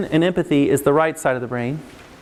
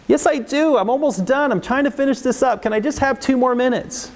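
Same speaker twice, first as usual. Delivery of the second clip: put-on higher, whiny kid voice bargaining; pleading kid; 8 semitones higher than usual. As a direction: beg like a child for two more minutes. Child pleading for more time.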